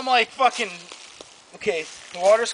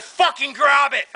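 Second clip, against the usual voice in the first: higher, louder, drawn-out command; retold insistent order; 6 semitones higher, 5 dB louder, slower than usual. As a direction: act out insisting after a refusal. Retold insisting after uncle refuses.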